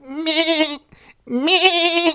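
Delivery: high nasal-free bleat, mock lamb. Kid imitating a lamb's bleat. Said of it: "Making these noises".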